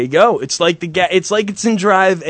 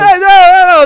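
loud, high outraged no no no; mock outrage; 9 semitones higher, 8 dB louder than usual. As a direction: cut them off with a loud mock protest. Host outraged at callers' talk.

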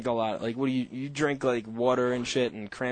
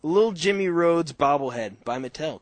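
usual speaking voice

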